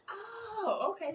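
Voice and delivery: high-pitched oh of sudden recognition; surprised realization. Retold or imitated. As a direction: let it dawn on you all at once. Realizing he's the neighbor.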